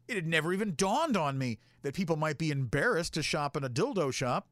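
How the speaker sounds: normal talking voice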